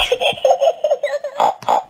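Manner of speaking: put-on high childish giggling; giggle. Girls laughing as they skip, high giggle. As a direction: giggle with delight.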